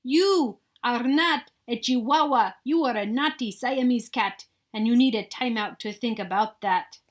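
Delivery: loud stern telling-off; mother cat ordering a timeout, loud and stern; scolding mother. Acted. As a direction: put him in timeout, sternly. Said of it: "she scolded".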